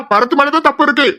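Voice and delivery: high-pitched, urgent insistence; urgent protest. Acted. Pleading to stop a fight.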